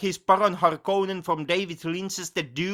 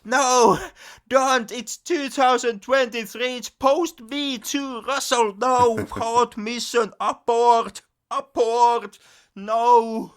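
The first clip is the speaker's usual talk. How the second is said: loud, higher, panicked pleading to stop; alarmed pleading; 8 semitones higher, 5 dB louder, slower than usual. Retold or imitated. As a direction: beg him to abort, half laughing. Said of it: "you're like".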